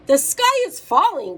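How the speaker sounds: high, loud storybook alarm; hammed-up warning call; alarmed cry. A narrator's reading. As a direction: cry it out like a panicked hen in a kids' tale. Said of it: "cried Henny Penny".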